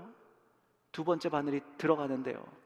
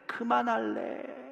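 pleading; 8 semitones higher, much slower than usual. Retold or imitated. Son's plea repeated.

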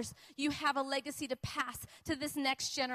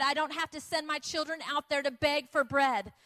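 usual speaking voice

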